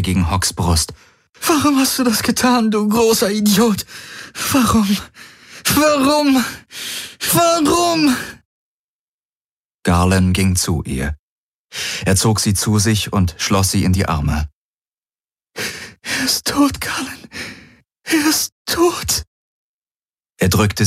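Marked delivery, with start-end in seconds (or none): 1.4-8.2 very high grief-stricken wail, building; hysterical, escalating anguish; wailing in pain. A narrator's reading. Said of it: "Kirai heulte vor Schmerz auf". 15.5-19.2 slow, high, sobbing words; high, drawn-out weeping; grieving sob. A narrator's reading. Weeping over dead friend.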